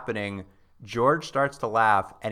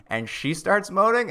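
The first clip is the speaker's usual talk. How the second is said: ordinary talk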